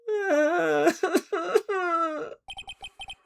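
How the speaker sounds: high wailing sob; fake crying wail. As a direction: wail like a caught brat. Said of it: "Cry, cry harder".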